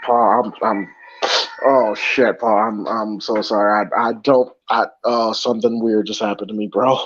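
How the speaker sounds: low, halting, tearful apology; overcome, voice dropped low; choked up. Real, not acted. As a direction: struggle to speak through real tears. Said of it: "the sound of you being choked up".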